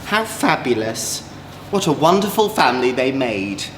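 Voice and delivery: ordinary delivery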